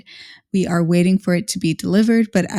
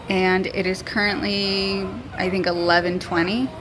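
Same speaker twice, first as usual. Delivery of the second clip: ordinary talk, nothing marked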